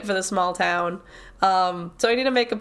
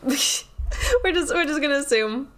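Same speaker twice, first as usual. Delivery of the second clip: laughing; 7 semitones higher, slower than usual. Real, not acted. Laughing repeat.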